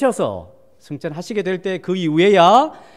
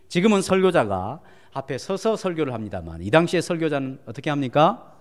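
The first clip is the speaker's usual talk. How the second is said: ordinary talk, nothing marked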